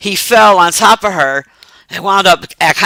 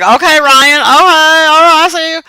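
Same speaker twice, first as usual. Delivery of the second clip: much higher, louder "all right, I see you"; impressed cheer; 11 semitones higher, 5 dB louder than usual. Retold or imitated. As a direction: hype up the actor. Cheering the actor's performance.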